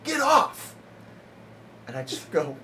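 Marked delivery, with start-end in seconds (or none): 0.0-0.7 much higher, loud barked "Get off!"; imitated heckler yell. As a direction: shout from the back of the room. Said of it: "this guy just goes".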